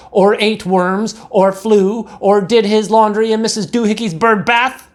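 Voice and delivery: scolding mother. A narrator's reading. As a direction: list his sins like an exasperated mother. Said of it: "she scolded".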